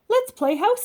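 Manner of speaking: put-on high, eager child's suggestion; little girl voice. Angela asking Amelia to play house, high child voice.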